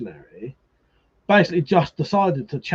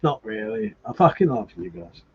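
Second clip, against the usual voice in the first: ordinary talk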